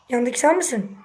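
usual speaking voice

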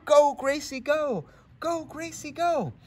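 high, loud cheer from the crowd; grandma cheering at the finish line; cheering yell. Acted. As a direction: yell her on from the sidelines. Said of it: "heard Grandma yell".

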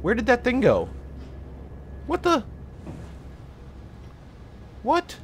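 high startled questions; high alarmed what. Real, not acted. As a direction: jump at the vanished thing. Thing vanished.

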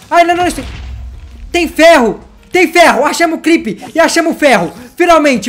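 high, loud excited exclamations; thrilled. Real, not acted. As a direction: cheer over the treasure. Finding iron, thrilled.

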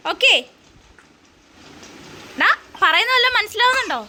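high questioning coo. Real, not acted. Mother asking baby if he understands, high baby talk.

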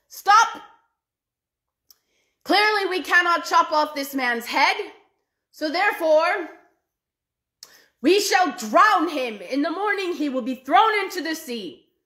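furious ruler ordering an execution; angry emperor. Acted. Emperor to his army, commanding angrily.

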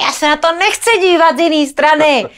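high, loud, near-shouting plea; mock hysterics. Wife begging husband to just comfort her, hysterical high plea. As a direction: replay the hysterical outburst at full pitch. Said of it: "Takže taková chviličku hysterčím".

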